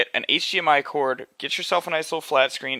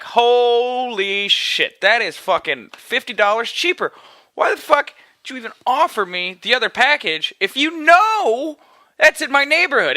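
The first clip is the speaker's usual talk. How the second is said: high, rising outburst, first words stretched slow; indignant disbelief; 9 semitones higher than usual. Retold or imitated. Customer learning of a cheaper package, indignant disbelief. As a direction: erupt in exaggerated high-pitched outrage. Acted outrage at withheld cheaper deal.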